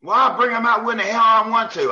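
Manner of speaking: high, loud defiant coach; imitated coach's shouted comeback; retold angry yell. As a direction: holler back as the irate coach. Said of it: "he yells, he goes".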